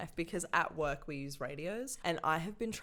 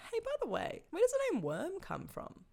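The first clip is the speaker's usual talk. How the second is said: much higher, bright customer-service voice; chirpy phone voice. Retold or imitated. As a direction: put on a cheery professional tone on the phone. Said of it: "and I'm like".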